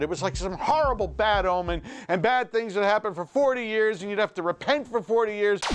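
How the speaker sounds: ordinary talk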